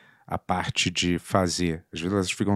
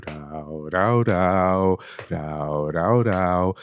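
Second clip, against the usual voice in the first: growling rau; 3 semitones lower, much slower than usual. Pretend. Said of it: "Uma música de drama".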